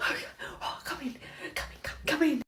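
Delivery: hushed conspiratorial whisper. Acted. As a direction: keep it low, secretive. Secret prank, hushed.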